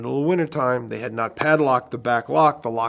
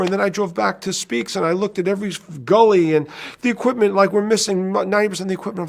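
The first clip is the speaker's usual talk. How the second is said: higher, uneasy crew guy reporting losses; driver voice, higher; 8 semitones higher, 5 dB louder than usual. Retold or imitated. Driver confessing the gear is gone, higher. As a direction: raise the pitch for the sheepish driver. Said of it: "He goes".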